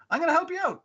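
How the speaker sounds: high-pitched eager offer; eager helper. Retold or imitated. Character offering to help out, high and eager.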